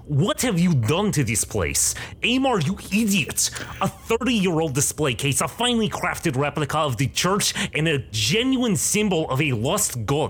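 high scolding tirade in a bad Russian accent; angry old woman. Gray-haired woman scolding Amar for the wreckage, high angry Russian accent. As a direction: berate him like an outraged curator. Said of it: "she turns to you and says".

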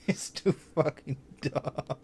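usual speaking voice

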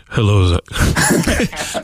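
very slow, drawn-out greeting from God. Shown as a demonstration. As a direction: stretch it out solemnly. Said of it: "Sound like Jonathan".

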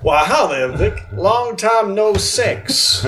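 lightly voiced sleazy shopkeeper; sleazy greeting. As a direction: greet him with a slow leer.

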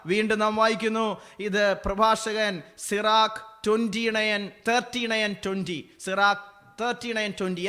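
loud, high scripture proclamation; ringing pulpit reading; fervent preaching. Acted. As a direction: proclaim the verse to the congregation. Preacher reads scripture aloud.

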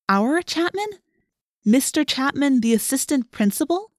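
put-on incredulous girl, pitch climbing. A narrator's reading. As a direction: question it in astonishment. Rachel incredulous at the news.

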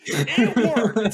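high, loud laughing shout; laughing triumph. Real, not acted. Defending his accent pickup lines, laughing triumphantly. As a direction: crow it through a laugh.

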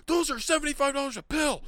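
very high, loud mock shriek; imitated screaming. Kid protesting his burned pills, mock screaming. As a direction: scream it like an outraged meltdown. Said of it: "Screaming up and down the yard".

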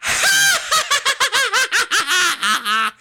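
high shouted creepy cackle; a shrieking villain cackle, loud; creepy laugh. Shown as a demonstration. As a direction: let rip a maniac laugh. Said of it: "Are you ready for mine?".

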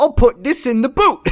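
high, hammed-up British voice; mock British accent. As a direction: put on a silly British accent. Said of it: "what they call the trunks across the pond".

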